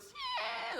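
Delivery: very high-pitched imitated screech; screeching chair. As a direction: screech like chair legs dragging. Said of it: "like, it's screeching and shit".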